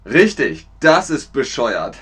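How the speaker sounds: ordinary delivery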